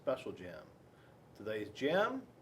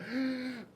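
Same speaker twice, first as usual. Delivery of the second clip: gasp; 10 semitones higher than usual. Acted. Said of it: "Penelope was like".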